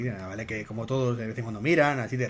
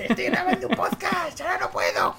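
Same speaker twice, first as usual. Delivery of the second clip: squeaky mock voice; 6 dB louder than usual. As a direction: parody his own phone excuse in falsetto. Mimics his own phone excuse.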